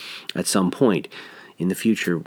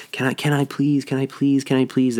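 put-on higher, whiny kid begging over and over; pleading child; 5 semitones higher than usual. Parent recalling a child nagging, begging in a higher pleading voice. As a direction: beg like a child who won't let up.

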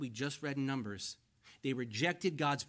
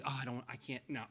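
lower, softer hedging voice; mock reluctance; 4 semitones lower, 7 dB quieter, faster than usual. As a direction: shrink back as if wriggling out of it. Voicing a believer reluctant to follow.

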